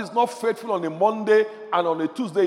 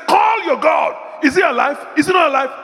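mocking taunt; 9 semitones higher, 4 dB louder than usual. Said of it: "He was laughing at the prophets".

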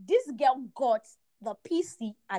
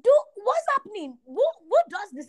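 high, louder incredulous protest; re-enacted outrage, high and loud; retold disbelief; 9 semitones higher, 7 dB louder than usual. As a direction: protest in baffled disbelief, pitched well up. Retold outraged reaction to God.

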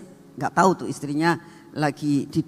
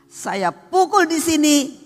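emphatic telling; 10 semitones higher, 5 dB louder, slower than usual. Retold or imitated. Acting out the blow.

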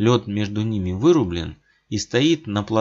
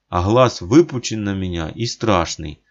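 ordinary delivery

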